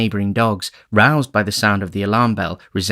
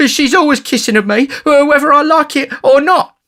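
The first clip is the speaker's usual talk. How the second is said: very high, loud wailing; snivelling tattletale, pitched way up; blubbering blame; 8 dB louder than usual. A narrator's reading. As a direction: whine the accusation at a high pitch. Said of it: "said Noah, blubbering".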